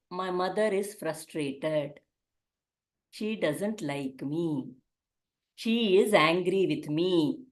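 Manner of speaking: soft, slow and hurt; hurt child's inner voice. Retold or imitated. A child quietly hearing that mother is angry. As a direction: let it sink in quietly, like a wounded child. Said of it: "All they hear is".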